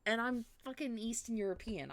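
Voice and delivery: ordinary talk, nothing marked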